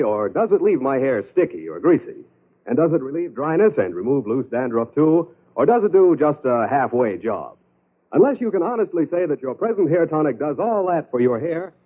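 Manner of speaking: normal talking voice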